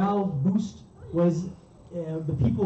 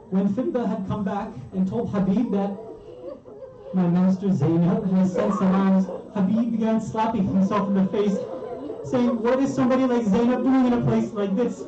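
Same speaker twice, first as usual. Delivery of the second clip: high, anguished lament; impassioned mourning preaching; 6 semitones higher than usual. Acted. Preacher telling of Habib's dismay for Zainab, anguished lament. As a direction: preach it as a grief-stricken outcry.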